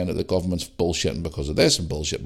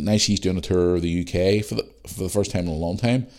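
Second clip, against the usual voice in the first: ordinary talk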